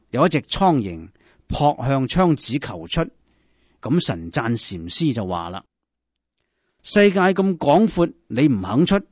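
ordinary delivery, nothing marked

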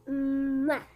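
a loud smacking mwah kiss; exaggerated baby kiss sound. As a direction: smack a big loud kiss like the little sister. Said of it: "That's how she give her kiss".

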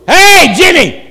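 sudden loud, high shout; calling out. Shown as a demonstration. Preacher to someone sleeping in church, calling out. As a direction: shout to wake a dozer. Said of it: "I'd like to call you out".

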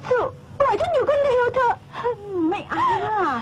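high, tearful sobbing plea; crying. Acted. Girl sobbing at her friend to leave her alone. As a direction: beg to be left alone through tears.

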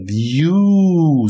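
high, loud whooshing gust; hurricane blow. A narrator's reading. The wolf blows down the wooden house, loud whooshing blast. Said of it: "dmuchnął niczym huragan".

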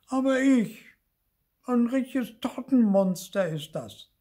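very high, loud little ghost voice; squeaky-high outburst; excited little ghost. A narrator's reading. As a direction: pipe up loudly as an indignant small ghost. Small ghost bursts in indignantly.

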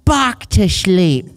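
slow, mock British baby talk; baby Brit accent. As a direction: read it in a silly, drawn-out British accent. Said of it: "Yours is like a baby Brit".

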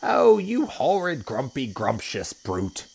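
high, slow, scolding outcry; indignant hippo cry. A narrator's reading. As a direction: cry out slowly as an outraged hippo. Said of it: "cried Humpy Rumpy".

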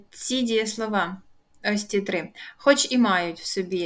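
usual speaking voice

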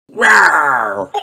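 high and a bit loud, full of joy; excited cry. A narrator's reading. George waking up feeling better, crying out. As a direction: wake the house with delight. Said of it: "cries George".

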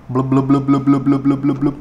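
bubbly blub sounds, playful; bubbling sound imitation. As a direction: mimic ink bubbling into the tank. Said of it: "wait for the bubble sound".

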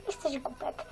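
ordinary delivery

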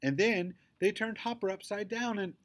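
put-on high, worked-up child's protest; unstoppable rant. A narrator's reading. Girl justifying herself to Daddy, ranting on. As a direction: barrel on, refusing to be interrupted. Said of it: "my engine is running too fast to stop".

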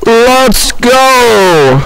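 put-on high, drawn-out old man cry. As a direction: do a hammy old man rallying call. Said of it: "That's my best old man voice".

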